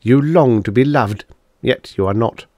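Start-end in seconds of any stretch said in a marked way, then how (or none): none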